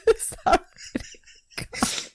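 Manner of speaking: breathless slow laughing whisper. Real, not acted. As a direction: squeeze it out through laughter. Laughing after tears.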